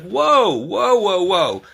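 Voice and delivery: high, drawn-out whoa to calm a yelling tenant; mock stop-right-there whoa; halting whoa. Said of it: "and I'm like".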